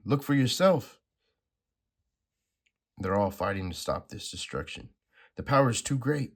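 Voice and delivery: lightly acted comic-book dialogue; dramatic comic reading. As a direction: read the panels like a dramatic script. Reads comic dialogue aloud.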